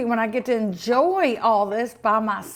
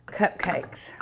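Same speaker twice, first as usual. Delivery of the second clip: whispered; 5 semitones lower, 7 dB quieter than usual. Real, not acted. Craving trailing off.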